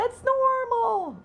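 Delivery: high, loud, slow mock refrain; hammed-up, drawn-out brush-off; mimicking dismissive friends. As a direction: stretch it out way up high.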